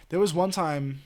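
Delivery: ordinary talk, nothing marked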